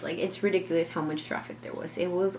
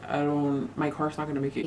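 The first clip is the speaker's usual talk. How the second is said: imitating husband; 4 semitones lower than usual. Narrator mimics Justin on the phone, lower.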